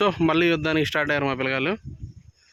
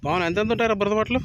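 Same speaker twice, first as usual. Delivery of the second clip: calling out; 8 semitones higher, 4 dB louder than usual. Real, not acted. Asking the kids in the water, raised call. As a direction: call it out loud and high so Pawan hears.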